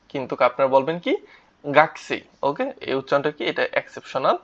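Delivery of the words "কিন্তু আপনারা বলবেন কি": ordinary talk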